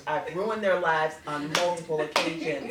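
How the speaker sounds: chuckling at her own confession; laughing admission. Real, not acted.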